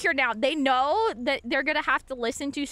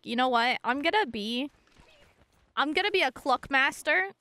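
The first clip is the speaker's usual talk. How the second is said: normal talking voice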